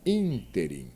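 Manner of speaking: "Ínterim" stressed on "Ín"; careful model of "Ínterim", first syllable strongest. Shown as a demonstration. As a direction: hit the opening "Ín" of "Ínterim". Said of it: "Veja como se pronuncia essa palavra".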